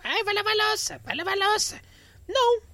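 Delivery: high whiny nagging mimic; mock a nagging parent, high pitched. Nagging roommate mocked.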